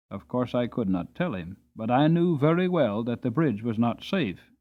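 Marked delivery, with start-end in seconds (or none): none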